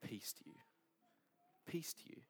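whispered, soft and tender; gentle peace. Acted. Risen Jesus greeting his frightened disciples, whispered tenderly. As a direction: breathe the blessing out gently. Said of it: "But what does he say to them?".

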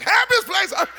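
high, sing-song mock of the ad slogan; mock cheery slogan. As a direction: sell it like a cheesy ad, then sour. Mocking Disney slogan again.

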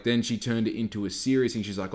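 normal talking voice

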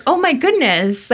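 high-pitched retold dismay; dramatised, appalled exclamation; aghast. Medium watching the attendant cry, aghast.

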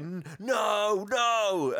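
high, put-on no; wife's protest. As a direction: shake her head at it. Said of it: "Missus Claus going".

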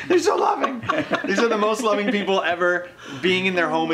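high, loud, laughing fake weepiness; mock choked up. Friend joking he's moved by the hosts, mock choked-up laughing. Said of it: "you'd think I'm getting choked up".

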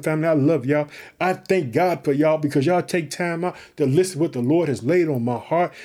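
ordinary delivery

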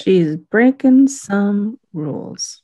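slow, loud, drawn-out declaration; playful admiration. Real, not acted. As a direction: stretch each word out with relish. Playful drawn-out cheer for character.

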